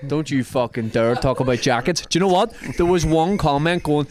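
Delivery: ordinary talk, nothing marked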